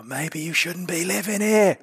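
loud, slow, high English-accented impression; Pierce Brosnan. Podcaster mimicking a film clip everyone knows, exaggerated English accent. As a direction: draw it out, rising to a shout, in an English accent. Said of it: "had an English accent in that one".

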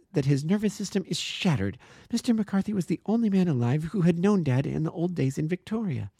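put-on high, urgent young woman defending her friend; earnest young woman. A narrator's reading. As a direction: plead with fierce conviction. Miss Turner about her father.

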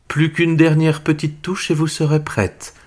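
a kind fairy, light and high; fairy godmother. Acted. Fairy godmother speaks to Cinderella. (voix de fée marraine aiguë)

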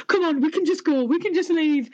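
high, anxious pleading; petrified. Retold or imitated. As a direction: imitate a scared man begging to leave. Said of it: "absolutely petrified".

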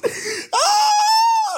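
high loud cackle; helpless loud laugh; loud shrieking laugh. Real, not acted. Reacting to funny chat.

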